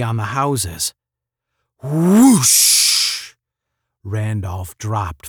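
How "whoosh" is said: high, loud imitated whoosh. A narrator's reading. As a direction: imitate a sudden blast of air. Imitates the dune's blast.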